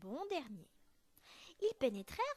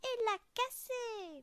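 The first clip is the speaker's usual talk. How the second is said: high, loud, slow little bear wail; tiny bear crying out; toute petite voix; 10 semitones higher, 7 dB louder, slower than usual. A narrator's reading. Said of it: "de sa toute petite voix". (petite voix aiguë qui se plaint)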